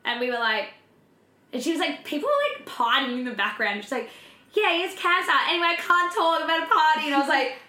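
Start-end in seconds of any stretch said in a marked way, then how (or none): 4.5-7.1 mock careless sister. Mocking careless sister on phone.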